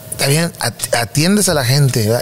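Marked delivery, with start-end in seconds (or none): none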